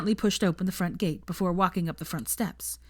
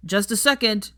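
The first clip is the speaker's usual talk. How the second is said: louder call from inside the house; shouting out; 4 semitones higher, 5 dB louder than usual. Acted. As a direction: call out to the door. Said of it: "shout out".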